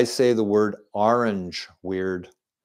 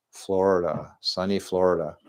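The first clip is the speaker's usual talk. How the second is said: normal talking voice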